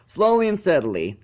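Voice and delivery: ordinary delivery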